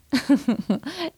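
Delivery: short light chuckle; brief amused laugh between questions. Real, not acted. Amusée après la réponse de l'élève. (petit rire amusé)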